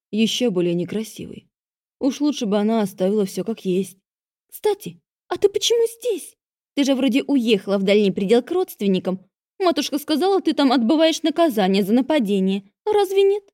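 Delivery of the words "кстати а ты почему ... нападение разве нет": high, whiny younger sister; young girl voice. A narrator's reading. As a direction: pitch up into a girlish voice. Half-sister voiced in narration.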